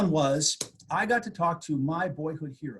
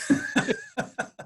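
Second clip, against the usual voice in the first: delighted laugh. Real, not acted. Delighted by his own joke.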